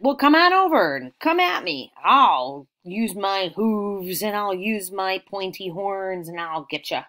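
bold goat challenge, loud at first then easing; biggest billy goat. Acted. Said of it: "then the biggest one said".

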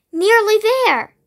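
high, encouraging storybook call. A narrator's reading. Fireflies to the tired bee, high light call. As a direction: call it lightly, pitched well up. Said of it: "called the fireflies".

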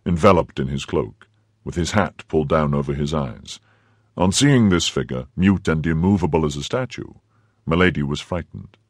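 ordinary delivery

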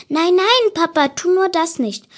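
put-on a young daughter begging her papa not to; Clara protesting. A narrator's reading. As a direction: cry out to stop her father. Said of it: "rief Clara abwehrend aus".

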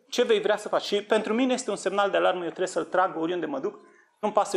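ordinary delivery

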